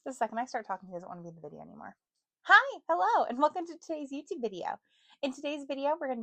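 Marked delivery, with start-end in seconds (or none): 2.4-6.2 higher, louder host greeting; youtuber intro. Acted. Vlogger retaking her upbeat video greeting. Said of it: "I don't like how I said that".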